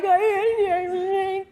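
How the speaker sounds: long high incoherent mock wail; hammed-up, drawn-out crying noise; hysterical crying imitation. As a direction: wail as if sobbing too hard to speak. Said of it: "I start crying hysterically".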